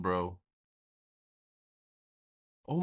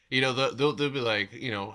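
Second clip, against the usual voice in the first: ordinary talk, nothing marked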